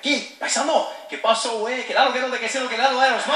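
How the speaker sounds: put-on high, loud Spanish with a Mexican accent; loud mock Mexican accent greeting. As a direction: greet a fellow Mexican loudly. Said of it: "He's like".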